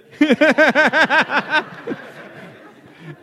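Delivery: a big high laugh bursting out; burst of laughter. Real, not acted. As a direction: laugh out loud at the memory. Laughing at her reaction.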